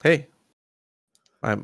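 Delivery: hoarse sick voice; talking through a cold. Real, not acted. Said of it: "me and my sick voice".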